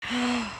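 sharp theatrical gasp, then falling; mock gasp. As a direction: gasp as if scandalized. Mock shocked reaction to story.

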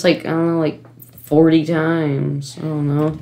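usual speaking voice

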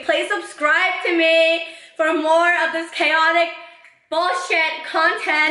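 slow, teasing outro; slow drawn-out plug. Real, not acted. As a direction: stretch it out slowly, playful. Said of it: "outro plug to viewers".

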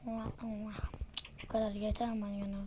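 ordinary delivery

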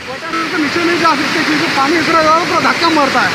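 yelling over the roar of water; shouting over waterfall. Real, not acted. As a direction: shout to be heard over the falls. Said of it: "इतना जोर जोर से बोल रहा".

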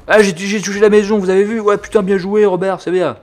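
high, loud celebrating soldier; mock excited cheer. Gunner congratulating Robert on hitting a house, mock elation. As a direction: crow over a lucky hit. Acts crew cheering a hit.

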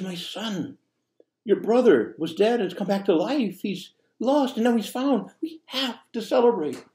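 preacher voicing the father pleading with his elder son. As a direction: beg the son to come to the feast, pitched high. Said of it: "pleads with him".

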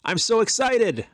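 high, loud excited burst; performed excitement for the dictation test; excited inflection. Shown as a demonstration. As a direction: sell the excitement with a big jump up in pitch and volume. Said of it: "Let's try that".

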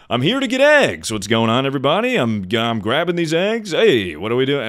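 high, booming self-parody announcement; announcing. Retold or imitated. Streamer acting himself at the grocery store, announcing loudly. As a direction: announce your errand to the whole store. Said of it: "like, announcing things that are happening".

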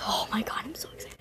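breathless whisper; excited whisper. Real, not acted. Gamer whispering while the pet hatches, excited whisper. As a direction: whisper it, barely containing joy. Nervous wait for the pet reveal.

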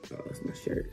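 ordinary talk, nothing marked

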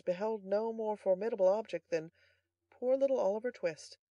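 usual speaking voice

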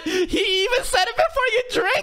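high-pitched frantic shouting; frantic protest. Real, not acted. Viewer protesting to the screen that he said it before the drink, frantic. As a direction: shout it high, as if pleading to stop them. Protests loudly at the scene he watches.

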